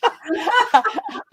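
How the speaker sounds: high laughing bark. Pretend. Guest shows her stage bark, a high yelp. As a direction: bark like a dog. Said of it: "barks on stage".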